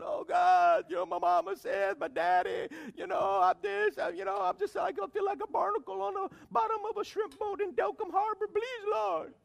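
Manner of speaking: hammed-up whining prayer, loud and high; mock a sorry-for-myself plea to God; whiny self-pitying prayer. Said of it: "He wasn't going like that".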